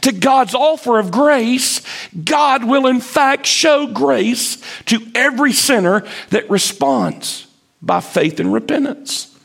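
full-force pulpit delivery; impassioned preaching. Acted. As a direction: preach it out to the congregation.

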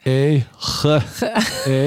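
slow letter by letter spelling. Shown as a demonstration. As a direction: spell it out slowly. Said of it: "Is that how you spell it?".

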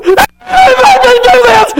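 put-on high, slow whiny child cry; mock whining child. Preacher voicing a child who complains, high whine. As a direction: whine like a sulking kid.

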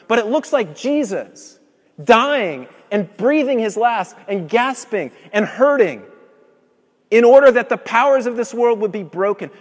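loud, driving sermon delivery; preacher hitting his climax; full-force preaching. Acted. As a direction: proclaim it to the whole room.

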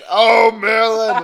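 high, drawn-out mock-horrified shout; hammed-up horror. Reader overdoes Severus's whisper of horror, shouting. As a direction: shout it slowly, loud enough to wake neighbours. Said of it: "He whispered with horror".

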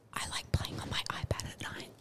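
hushed ASMR whisper; feed the phrase in a very soft whisper. Shown as a demonstration. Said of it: "You gotta whisper it into the microphone".

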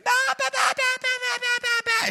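a preacher imitating a noisy five-year-old girl. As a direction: bounce out a toddler's bah-bah-bah up high. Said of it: "this little girl".

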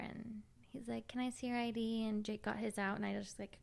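normal talking voice

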